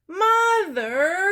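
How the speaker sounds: high, loud shout; calling mother. A narrator's reading. Child shouts for mother, high and loud. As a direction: yell for Mom down the house.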